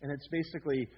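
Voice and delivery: usual speaking voice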